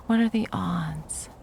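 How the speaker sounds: slow, teasing whisper. Acted. Ex mocking her trapped lover in a sly whisper. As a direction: breathe the question like a private joke. Taunting captive ex.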